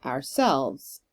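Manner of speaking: "ourselves" with the beat on "selves"; light "our", emphatic "selves"; stress on SELVES. Shown as a demonstration. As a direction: lean into the second half of "ourselves". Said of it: "emphasis on the selves part of ourselves".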